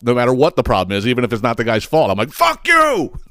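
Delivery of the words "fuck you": high, yelping mock outburst; mock yell. Comic yelling at the customer ahead in line. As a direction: squeal it out in fake rage.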